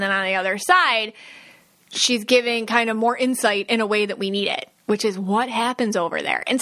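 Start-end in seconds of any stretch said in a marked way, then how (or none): none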